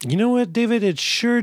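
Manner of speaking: high, playful you know what; mock indignant. Real, not acted. Host replying to cohost David, mock indignant. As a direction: answer back in mock outrage.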